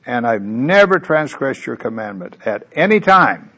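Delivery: put-on older son complaining to father, loud and self-righteous; self-righteous complaint. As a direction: insist on the many years, drawing them out. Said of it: "Isn't that self-righteous?".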